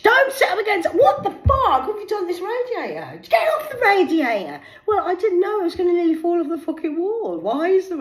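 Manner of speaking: high, loud scolding woman; shrieking friend. Retold or imitated. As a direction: shriek at her over the radiator. Acted Laura scolding.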